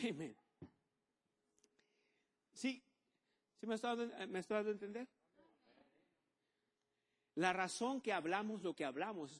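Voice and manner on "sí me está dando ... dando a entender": ordinary delivery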